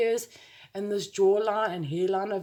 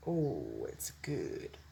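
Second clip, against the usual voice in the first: soft whispered aside; whispering; 11 dB quieter, much slower than usual. Real, not acted. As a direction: breathe it out in a whisper. Quiet aside while brushing eyes.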